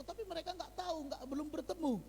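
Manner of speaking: usual speaking voice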